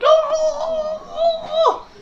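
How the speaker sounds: long, high-pitched wail; mock wailing oh. Frost distorts into the trap, long high mock wail. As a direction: wail as if sucked into a bottle. Imitates being sucked into trap.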